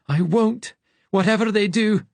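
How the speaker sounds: high panicked vow; high frightened voice. A narrator's reading. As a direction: pitch up, nearly breaking with fear. Frank clutching hands, terrified.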